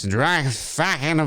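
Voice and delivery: put-on slow, slurred drunk British doctor; hammed-up British drunk mumble; Doctor Loomis. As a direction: slur it out like a sloshed Brit, collapsing into a laugh. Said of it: "Drunk Loomis".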